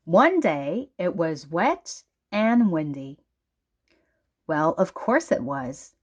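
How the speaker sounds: ordinary delivery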